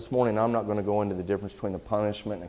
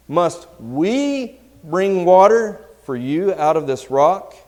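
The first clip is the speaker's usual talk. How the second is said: WE stretched out high and loud; stress on WE; 7 semitones higher, 11 dB louder, slower than usual. Acted. Moses challenging the rebels at the rock, heavy stress on "we". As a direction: punch and hold the word "we", slow and loud. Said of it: "The reason I emphasize the word "we".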